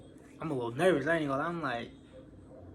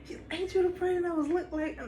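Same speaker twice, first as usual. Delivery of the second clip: surprised. Retold or imitated. Friend recognizing him, calling out.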